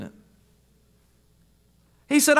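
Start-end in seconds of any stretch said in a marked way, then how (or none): 2.1-2.4 raised, insistent pulpit voice; preaching at full force. Acted. Preacher scolding lazy believers, fervent and raised. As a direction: drive it home to the congregation.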